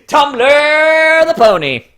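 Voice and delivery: high, loud, drawn-out mock title; mock announcement. Mocking the OC, a high, slow, loud mock announcement. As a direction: announce it like a grand reveal.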